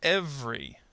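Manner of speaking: "Every." said as EV-ree; dropped middle syllable. Shown as a demonstration. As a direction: demonstrate "Every." in two syllables. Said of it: "schwa plus R here kind of disappears".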